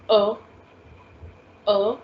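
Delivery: short neutral "a", like a schwa "uh"; E without accent. Shown as a demonstration. Teacher showing students the unaccented E sound, a plain schwa. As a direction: say "a" as a loose, unaccented French e. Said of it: "Without accent, the sound of E is".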